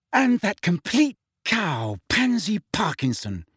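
high, slow, scornful venom; vicious. A narrator's reading. Hermione complaining about a rival prefect, viciously. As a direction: spit out the insult, pitched high. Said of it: "said Hermione viciously".

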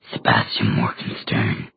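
deep, breathy demon whisper. Acted. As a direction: hiss the name like a secret. Demon reveals the enemy.

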